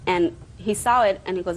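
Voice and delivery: usual speaking voice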